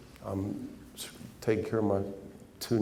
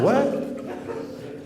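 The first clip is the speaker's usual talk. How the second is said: laughing what. Retold or imitated. Laughing disbelief at insult.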